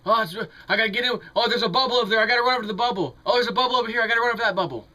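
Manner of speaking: fast, high, frantic bubble-chaser; mock a jittery day trader; rapid hyped trader panic. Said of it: "you sound like a stupid day trader".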